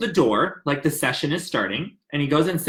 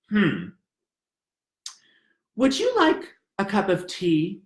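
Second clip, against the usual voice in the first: slow speech. Retold or imitated. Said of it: "he doesn't speak fast, ever".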